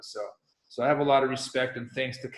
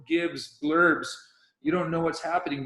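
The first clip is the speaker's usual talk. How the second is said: ordinary delivery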